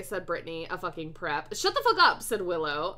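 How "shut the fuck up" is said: high, loud snap; angry outburst. A narrator's reading. Willow silencing a prep in the story, angry outburst. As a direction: cut them off with a sharp yell. Acted quarrel, snapping at prep.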